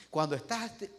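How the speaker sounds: usual speaking voice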